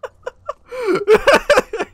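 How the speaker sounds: very high, loud laughing fit. Real, not acted. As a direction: wheeze into a loud high cackle. Laughing at game event.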